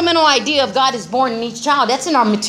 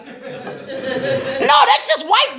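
mock denial; 5 semitones higher, much slower than usual. Denying racism, joking.

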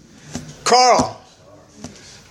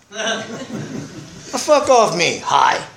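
mocking street reply; much slower than usual. Street-wise reply to a greeting.

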